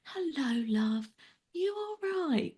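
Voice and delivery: high, drawn-out sing-song condescension; mock someone cooing slowly down at her; patronising imitation. Stranger talking down to a wheelchair user, mock-patronising. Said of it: "squatted down to her eye level and went".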